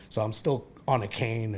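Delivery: usual speaking voice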